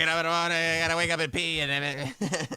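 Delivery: high fast sports-radio voice; Scott Farrell impression. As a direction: lightly mimic a sports talker. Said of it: "Sound like Scott Farrell".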